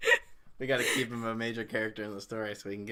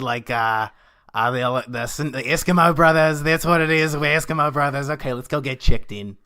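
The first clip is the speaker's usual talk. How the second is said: loud eager tour guide; 4 semitones higher, 9 dB louder than usual. Acted. Wilson voiced loud and eager.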